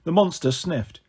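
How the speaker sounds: usual speaking voice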